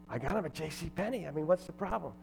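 soft, slightly put-on timid voice; meek reply. MC answering an upset heckler about J C Penney, meek. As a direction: answer the shouter quietly, baffled.

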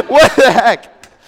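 mock bewildered. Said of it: "You're like".

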